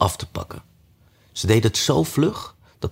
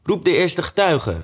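voiced king; 10 semitones higher than usual. A narrator's reading. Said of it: "zei de koning".

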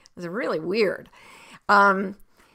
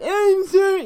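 high, loud mocking "I'm sorry"; exaggerated squawked apology; wrong way to apologise; 13 semitones higher, 11 dB louder than usual. Shown as a demonstration. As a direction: screech the apology insincerely. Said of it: "you don't say it like".

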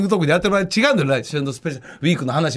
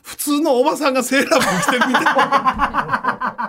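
words through laughter; giggling as he describes; laughing; 7 semitones higher than usual. Real, not acted. Laughing while describing photo.

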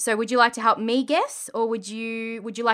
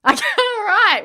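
laughing; 11 semitones higher, 9 dB louder than usual. Real, not acted. Laughing while starting game.

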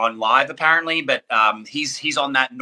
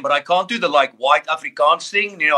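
ordinary talk, nothing marked

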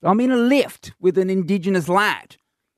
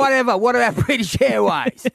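dismissive retort; 5 semitones higher than usual. Retold or imitated. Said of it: "That's what I wanted to say".

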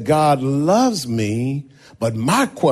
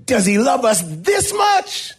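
much higher, shouted; children shouting; 12 semitones higher than usual. Acted. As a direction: kids yelling up at the teacher. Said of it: "And then they said".

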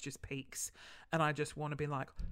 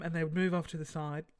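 ordinary talk, nothing marked

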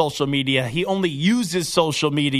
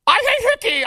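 much higher, louder hammed-up yell; mock announcer shout; 4 dB louder, faster than usual. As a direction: shout it like a big home run call. Mock broadcaster call continues.

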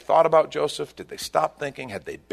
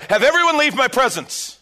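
loud anguished cry, pitched high; cried out; 12 semitones higher, 6 dB louder than usual. Acted. Joseph crying out to clear the room, loud. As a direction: cry it out with everything. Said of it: "He cried out".

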